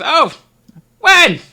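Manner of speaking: high, loud indignant call; old woman calling back. Acted. Esme shouting back at her husband, high and loud. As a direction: shout your question across the house. Couple argue about tavern.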